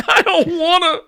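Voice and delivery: high mock sob rising to a loud cry; hammed-up wailing. Host parodying Tom Holland begging to be dusted, hammed-up wailing. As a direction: build from whimper to comic wail.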